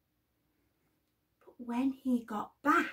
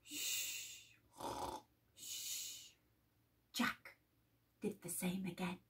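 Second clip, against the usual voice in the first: hushing whisper; 4 semitones lower, 16 dB quieter than usual. A narrator's reading. Hushing as giant sleeps.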